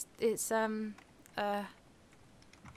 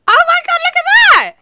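loud, much higher cry of amazement; shocked shout; 15 dB louder, faster than usual. Real, not acted. Friend shouting in shock as the mining turtle starts digging, loud and high. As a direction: yell it out in sudden disbelief.

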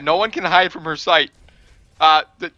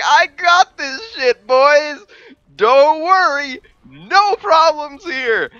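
high, loud, slow hollered bravado; cocky self-quote; 10 semitones higher, 7 dB louder, slower than usual. Retold or imitated. Player retelling own charge to the team, cocky hollering. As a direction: holler it with hammed-up confidence. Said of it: "I was like".

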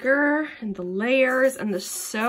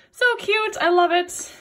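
excited; 7 semitones higher, 6 dB louder than usual. Real, not acted. Gushing over a handmade box.

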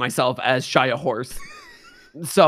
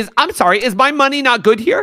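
indignant customer; 10 semitones higher, 8 dB louder than usual. Acted. Horse customer acting offended.

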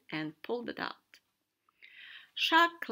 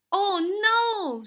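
high, very loud cry; loud, high-pitched dismay; alarmed shout; 10 semitones higher, 13 dB louder than usual. A narrator's reading. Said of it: "Sea Racehorse shouted".

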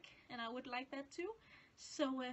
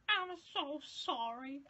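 slow, drawn-out sheepish apology; slow embarrassed apology; 5 dB louder, slower than usual. Retold or imitated. Apologizing to the actor, slow and sheepish. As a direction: make it sound mortified. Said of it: "I was just like".

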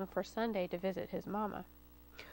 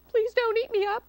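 high, louder desperate plea; pleading; 10 semitones higher, 6 dB louder than usual. A narrator's reading. Rooter begs the fox not to eat him, high plea. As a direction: beg for your life. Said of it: "Rooter pleaded".

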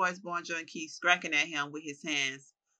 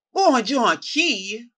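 slow, drawn-out furious shout, much higher and louder; enraged fighter shouting at his old rival; rage yell; 7 semitones higher, 11 dB louder, much slower than usual. Acted. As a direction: bellow the name in disbelieving fury. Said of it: "he yells in a fit of rage".

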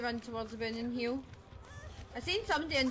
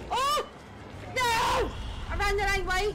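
high panicked shouting; frantic yells while fleeing; being chased; 9 semitones higher, 4 dB louder, slower than usual. Real, not acted. Fleeing killer, wrong turn.

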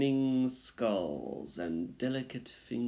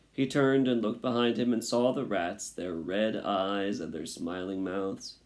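usual speaking voice

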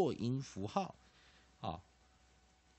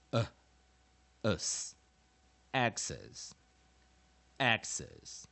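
louder, measured phonics recital; teacher's recap drill; 2 semitones higher, 5 dB louder, slower than usual. Shown as a demonstration. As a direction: recite each step loudly, pausing for repetition. 最后一次进阶解释.

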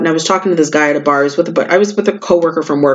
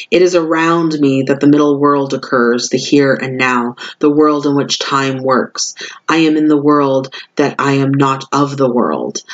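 solemn card reading; 4 semitones lower than usual. Acted. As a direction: read it like a sacred invocation. Reads the oracle card text.

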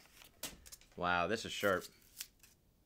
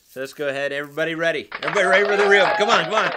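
building excited shout, higher and louder; excited hype; 5 semitones higher, 12 dB louder, much faster than usual. Real, not acted. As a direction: rev everyone up for the reveal. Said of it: "I get excited around here".

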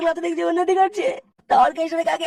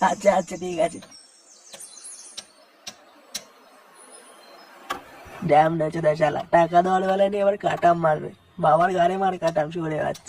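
put-on much lower old workman muttering to himself; old mistri grumbling; 11 semitones lower than usual. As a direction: grumble under your breath once the boss is gone. Mistri grumbles after boss leaves. (বাবু চলে যাওয়ার পর বুড়ো মিস্ত্রির গজগজ)

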